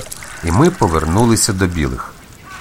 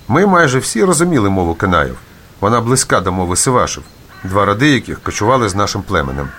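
ordinary delivery

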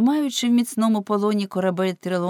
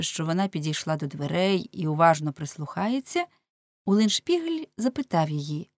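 ordinary delivery, nothing marked

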